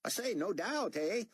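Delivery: high excited Australian chatter; Australian accent, excited; Aussie accent. A narrator's reading. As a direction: gush like a thrilled Aussie fan. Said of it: "his accent making David guess he was an Aussie".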